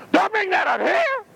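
high, scandalised objection; hammed-up prim outrage; mock indignation. Acting congregation forbidding the subject.

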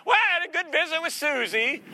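high, chatty busybody voice; gossipy woman. Acted. Pastor mimics a gossiping churchgoer, high and chatty. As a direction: lift it up like a pleased gossip.

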